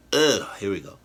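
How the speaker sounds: ordinary delivery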